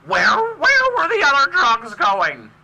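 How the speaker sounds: high, slow, loud bat voice. Retold or imitated. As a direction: squeeze out a shrill bat screech. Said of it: "That's my bat voice".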